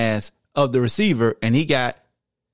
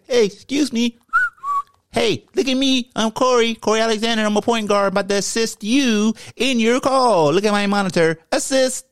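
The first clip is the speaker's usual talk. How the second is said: higher, lightly voiced point guard showing off; mock Corey voice; 10 semitones higher than usual. Host mocking the point guard waving for attention, higher. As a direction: play the flashy guard calling attention. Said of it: "You gotta say".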